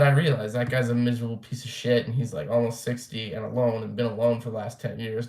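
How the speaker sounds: ordinary talk, nothing marked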